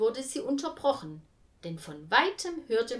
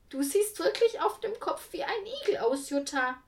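high, louder laughing tease; teasing call; 10 semitones higher, 4 dB louder than usual. A narrator's reading. Egge teases Jutta about her hair, high amused call. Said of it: "rief sie".

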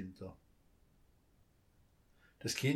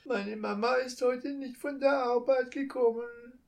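put-on much higher, louder little child's voice, tearful; sobbing child; 12 semitones higher, 5 dB louder than usual. A narrator's reading. Child tells the thief about mama, tearfully. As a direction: speak as a small child close to tears. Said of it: "schluchzte das Kind".

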